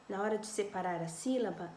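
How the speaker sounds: ordinary delivery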